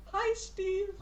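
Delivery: high, little gnome voice; high-pitched cute hello; small gnome greeting. Acted. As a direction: pipe up from down at thigh height. Small gnome greets the man above.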